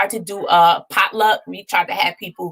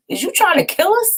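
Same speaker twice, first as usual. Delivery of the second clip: higher, imitated outrage at the salty cakes; retold mad sister; 13 semitones higher than usual. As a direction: squeak out the little sister's indignant question. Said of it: "she was so mad".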